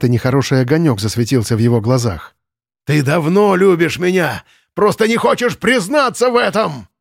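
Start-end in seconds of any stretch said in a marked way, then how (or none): 2.8-6.9 high frantic yell; shouting. A narrator's reading. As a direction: scream it at her, unhinged. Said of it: "закричал Ленц". (с криком)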